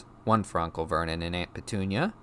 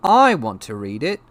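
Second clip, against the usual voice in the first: put-on higher, loud angry boy; furious demand; 6 semitones higher, 11 dB louder than usual. A narrator's reading. Boy demanding his own letter, loud furious insistence. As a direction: insist hotly, voice raised. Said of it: "said Harry furiously".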